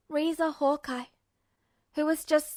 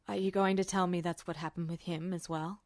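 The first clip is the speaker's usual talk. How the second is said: a lower, guilt-laden man's voice; worried officer pressing a question; Roy, lower voice; 8 semitones lower, 7 dB quieter than usual. A narrator's reading.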